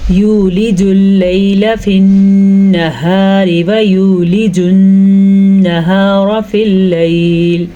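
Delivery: slow, measured tajweed chant; Quranic recitation. Shown as a demonstration. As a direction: recite it slowly and evenly as in tilawat. Teacher recites the verse.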